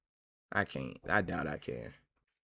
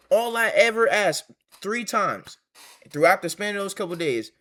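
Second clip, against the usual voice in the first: much higher and louder, indignant venting; heated rant; 9 semitones higher, 6 dB louder than usual. Real, not acted. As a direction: let the frustration burst out, pitched way up. Venting anger at ex mid-story.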